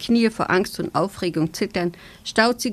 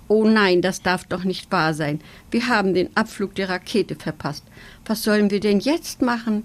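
ordinary delivery

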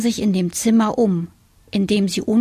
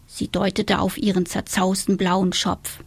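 ordinary delivery, nothing marked

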